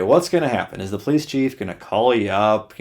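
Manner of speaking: ordinary talk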